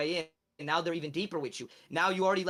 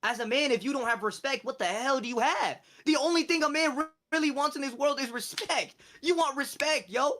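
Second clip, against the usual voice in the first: fired-up preaching; 7 semitones higher, 4 dB louder than usual. Real, not acted. Preaching about respect to chat.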